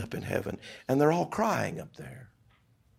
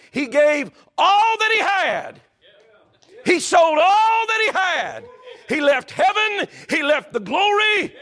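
high, loud shouted preaching; preaching at full force; 9 semitones higher, 6 dB louder than usual. Acted. Preacher shouting to the congregation at full force. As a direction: lift the room with a ringing cry. Preaching climax about Jesus buying field.

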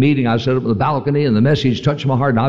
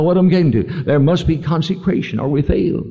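ordinary talk, nothing marked

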